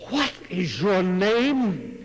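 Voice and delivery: king reddening with rage, slow and loud; rising anger. A narrator's reading. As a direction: let the anger build in every word. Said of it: "the king began to crimson".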